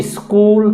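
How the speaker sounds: "स्कूल" with a short i before the S; added initial i. Shown as a demonstration. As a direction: say "स्कूल" as is-kool. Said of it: "मेरा उच्चारण खुद का निकलेगा".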